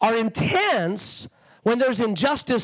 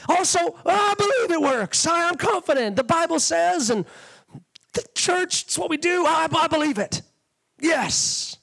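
higher, insistent, self-assured voice; confident faith; 6 semitones higher than usual. Retold or imitated. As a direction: talk yourself into believing. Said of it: "But faith at the same time goes".

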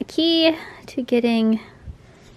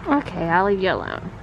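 ordinary talk

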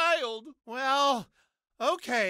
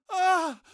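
sharp loud yelp of shock; short loud gasping shout; startled cry; 4 semitones higher, 4 dB louder than usual. Acted. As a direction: cry out as if suddenly hurt. Sudden pained outcry.

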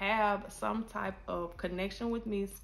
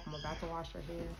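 ordinary delivery

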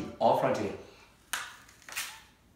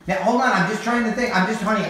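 raised, higher voice; louder, pushing back; defensive raised voice; loud protest; 8 semitones higher, 8 dB louder than usual. Real, not acted. Dad protests loudly.

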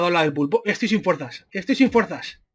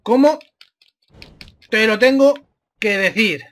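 broken up with long pauses; exasperated word by word. Real, not acted. Gamer spelling it out for a stubborn opponent, exasperated word by word. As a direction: separate "Cómo", "te lo tengo", "que decir". Repeats question slowly, piece by piece.

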